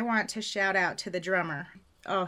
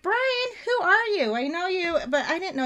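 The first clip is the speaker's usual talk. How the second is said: surprised; 9 semitones higher than usual. Retold or imitated. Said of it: "I'm like".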